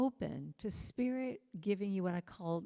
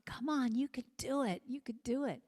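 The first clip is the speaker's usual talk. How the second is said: lightly voiced, higher sweet coaxing; sweet encouragement; 6 semitones higher than usual. Friend voicing a parent cheering a baby's first steps, sweetly. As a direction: coax a toddler sweetly, a little higher. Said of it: "so sweet going".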